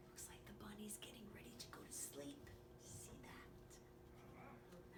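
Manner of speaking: quick soft whisper. Acted. Pointing out the sleepy bunny in a whisper. Whispered aside in bedtime story.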